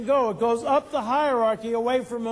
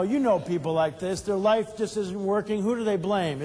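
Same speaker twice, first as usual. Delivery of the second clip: normal talking voice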